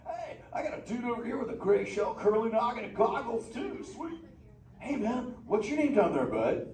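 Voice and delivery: a hyped, easygoing beach dude of a turtle; Crush the sea turtle. Acted. Sea turtle greeting the kids in the theater, stoked surfer-dude voice.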